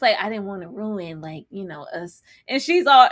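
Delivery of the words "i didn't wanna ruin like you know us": very soft mock murmur; barely voiced, put-on sincerity; mimicked mumbling. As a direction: imitate his hushed, intimate delivery. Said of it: "The way he says".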